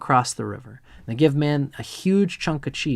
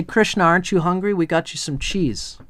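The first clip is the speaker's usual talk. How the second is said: eager call to Krishna; 6 semitones higher, 3 dB louder than usual. Retold or imitated. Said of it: "And they say".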